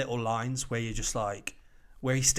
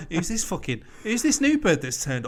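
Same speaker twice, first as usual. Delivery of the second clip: cocky challenge; 5 semitones higher, 6 dB louder than usual. Acted. Acted bravado of a TV character.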